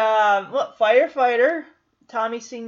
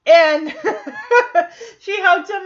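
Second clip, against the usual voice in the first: laughing; 6 semitones higher, 6 dB louder than usual. Real, not acted. Fan laughing retelling hug.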